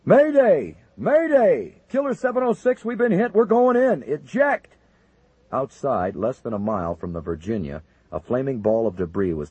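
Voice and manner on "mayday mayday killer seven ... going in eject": high, loud panicked radio call; panicked mayday. Acted. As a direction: scream it as you go down. Plane hit, pilot calls mayday.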